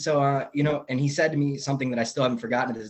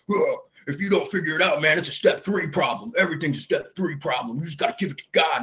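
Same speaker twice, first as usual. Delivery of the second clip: higher, lightly mocking voice of preachy AA guys; mocking old-timers; 4 semitones higher than usual. Group of AA guys cornering a newcomer, mock preachy and higher. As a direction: play the know-it-all old-timers, pitched up. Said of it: "they were like".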